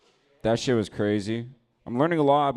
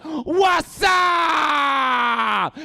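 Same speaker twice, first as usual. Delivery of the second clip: much higher, louder hammed-up yell; mock shout; 10 dB louder than usual. Jumping into the fight, much higher hammed-up yell. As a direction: bellow it, joining in.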